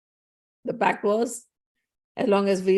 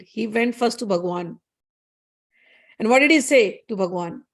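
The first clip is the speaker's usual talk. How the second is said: usual speaking voice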